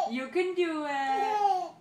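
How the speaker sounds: loud, slow cheering. Real, not acted. Cheering toddler on, slowed.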